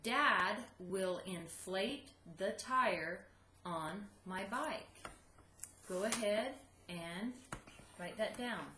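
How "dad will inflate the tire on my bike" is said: slow, even read-along; slow choral reading. Shown as a demonstration. Teacher reading along with class, slow. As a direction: lead the kids through it slowly. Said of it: "say that out loud with me".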